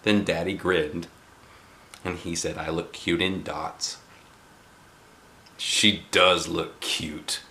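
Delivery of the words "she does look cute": put-on lower, slow fond old man; a grandfather praising; grandpa. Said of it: "said Grandpa Miller".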